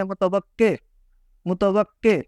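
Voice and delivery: متوقع said as mu-ta-waq-qe; changed vowels. Shown as a demonstration. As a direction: end each متوقع on "qe". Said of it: "اس کے اعراب تبدیل ہو جاتے ہیں". (متوقع میں قاف کے نیچے زیر)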